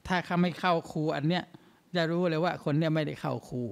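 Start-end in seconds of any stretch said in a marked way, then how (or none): none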